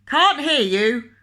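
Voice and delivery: loud, slow shouted complaint; loud cry. Acted. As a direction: shout it back over the counter. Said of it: "cried the grocer".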